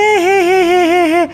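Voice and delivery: drawn-out fake crying sobs; hammed-up sobbing; mock sobbing. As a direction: wail it like a jilted girlfriend. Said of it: "তুমি কাঁদছো কেন?".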